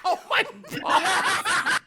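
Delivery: slow, high, laughing disbelief; real laughing oh my God. Real, not acted. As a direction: gasp it out between laughs. Laughing after the slap.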